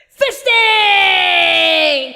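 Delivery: high, loud, long-held yell; cheering shout. Acted. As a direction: holler it, stretching the last syllable. Cheering cyclist shouting solidarity.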